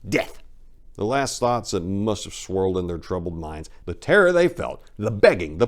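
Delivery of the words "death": ordinary delivery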